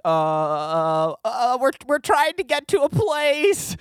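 stalling, drawn-out, high-pitched answer; mock nervous reply. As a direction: stammer it high and slow, like kids caught out. Acted nervous reply to the stranger.

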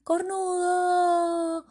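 high, slow drawn-out taunting yell; mocking shout. Biker taunting the jogging man, long mocking shout. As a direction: stretch the insult out high and loud. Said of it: "le grita".

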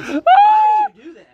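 high, loud exasperated yell; exasperated shout. Real, not acted. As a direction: shout it in disbelief. Friend outraged at the Lions pick.

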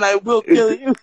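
ordinary delivery

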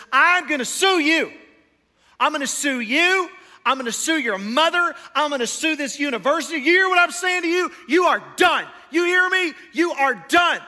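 loud, high-pitched furious tirade; acted-out angry dad, shouting each threat; threatening shout. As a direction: bellow legal threats like an enraged father. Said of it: "his dad is threatening the lawsuit".

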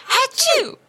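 high, showy mock sneeze; exaggerated sneeze. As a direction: ham up a fake sneeze. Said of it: "the exaggerate".

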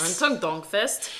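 put-on Erntedankfest in a German accent. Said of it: "That was my German accent".

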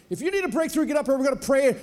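high hyped-up revival preacher; mock altar call.

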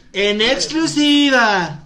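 slow, loud dramatic announcer voice; gossip show announcer. Pretend. Host teasing Sofi, slow loud gossip-show intro. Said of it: "Otra vez, a ver".

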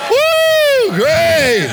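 high loud whoop into praise; shouted worship cry; ecstatic whoop. Real, not acted. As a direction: let out a joyful woo.

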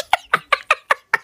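high wheezing laugh; high, rattling laugh; real laughter. Real, not acted. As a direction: crack up helplessly at your own joke. Cracks up at own joke.

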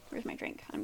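whispered aside. Real, not acted. Muttered aside to herself.

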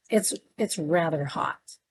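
ordinary delivery, nothing marked

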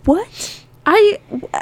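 high-pitched, incredulous sputter; lost for words, pitch shooting up; stunned disbelief. Real, not acted. Listener reacting to neighbours who never called, stunned disbelief.

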